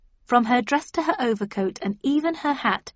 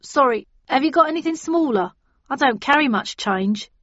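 cabbie voice; 5 semitones higher, 5 dB louder than usual. A narrator's reading. Cabbie apologizes.

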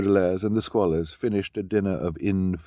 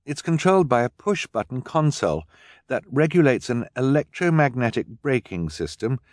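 put-on higher, keen girl explaining; young girl Violet; 6 semitones higher, 4 dB louder than usual. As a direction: explain eagerly, inventor-like.

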